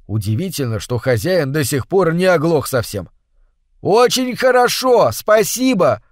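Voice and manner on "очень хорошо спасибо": higher, slow shout. Acted. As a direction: shout over a roaring waterfall. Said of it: "выкрикнул Картер". (выкрикивает, медленно и громко)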